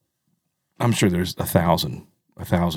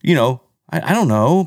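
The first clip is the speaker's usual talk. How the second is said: ordinary delivery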